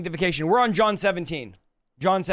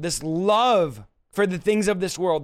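ordinary delivery

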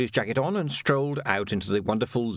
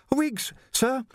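put-on much higher, polite young Jocasta, a touch breathless; young woman voiced; 14 semitones higher than usual. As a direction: play a well-mannered girl treading water. Jocasta answers Vimes.

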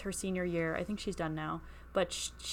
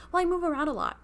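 higher, louder breezy excuse; imitating classmate; 9 semitones higher, 5 dB louder, faster than usual. Classmate shrugging off her moves, mock voice. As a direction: toss it off breezily.

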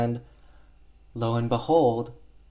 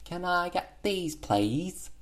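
put-on little girl; 8 semitones higher, 4 dB quieter, slower than usual. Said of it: "she's like".

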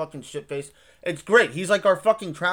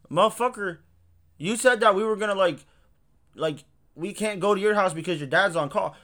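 raised, fed-up complaint; exasperated; 5 semitones higher, 4 dB louder than usual. Retold or imitated. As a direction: call him out, annoyed. Retelling exasperated outburst at friend.

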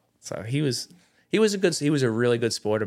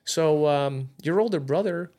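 higher, hesitant mock mom voice; mother breaking news; 7 semitones higher, slower than usual. Mother tells son about older brother, tentative high voice. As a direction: imitate a mother gingerly easing into news. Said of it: "her way of saying".

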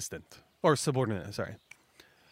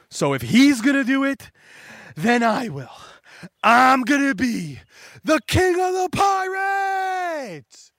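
Buggy works himself up until he roars his vow; building to a shout; 8 semitones higher, 6 dB louder than usual. Retold or imitated. Buggy vowing to be pirate king, building to a roaring shout. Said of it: "and he says".

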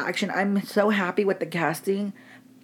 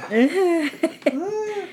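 high laugh; 11 semitones higher than usual. Real, not acted. Laughs at own joke.

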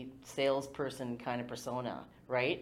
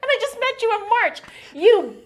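much higher, choked up with grateful tears; real tears welling as she thanks him; tearful, about to cry; 11 semitones higher, 10 dB louder than usual. Real, not acted. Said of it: "oh my God, I'm gonna cry".

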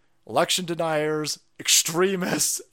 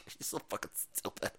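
mock hushed scoff; 8 semitones lower, 15 dB quieter than usual. Mocking critics, whispered.

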